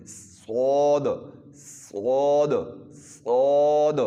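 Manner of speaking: صاد drawn out slowly, loud, lips rounded; long full-mouthed صاد; slow heavy saad. Shown as a demonstration. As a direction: stretch a thick rounded صاد. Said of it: "منہ کو گول کر لیں".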